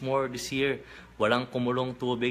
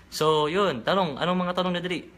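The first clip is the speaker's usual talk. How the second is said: calling out; 7 semitones higher, 5 dB louder than usual. Real, not acted. Calling chat for questions.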